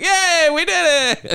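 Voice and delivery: very high, slow mock triumph; sarcastic celebration; mock cheer. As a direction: cheer like a dad breaking the finish ribbon. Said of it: "You know, like".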